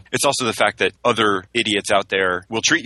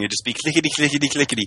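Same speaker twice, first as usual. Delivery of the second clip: mock clicking noise; 5 semitones higher than usual. Mocking an imagined rally drowned out by clickers. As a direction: chant clickety, clickety, clickety, a bit higher.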